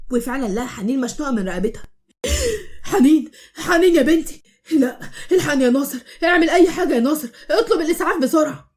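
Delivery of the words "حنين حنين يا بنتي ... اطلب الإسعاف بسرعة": higher, louder, desperate cries for help; panicked shout. Acted. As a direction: scream for your daughter. Father finds daughter hanged.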